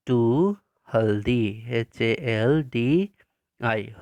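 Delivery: slow, spaced-out letters for copying; slow dictation spelling. Shown as a demonstration. Teacher dictating spelling slowly to pupils. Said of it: "यहाँ पे डी लिखेंगे हम लोग".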